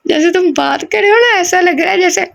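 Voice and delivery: high mock whiny toddler voice; a hammed-up babyish whine. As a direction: tease like a three-year-old crying. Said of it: "तीन साल का बच्चा".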